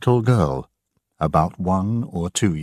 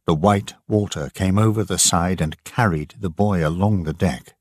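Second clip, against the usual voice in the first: plain reading voice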